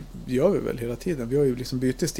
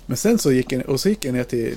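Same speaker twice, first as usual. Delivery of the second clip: ordinary delivery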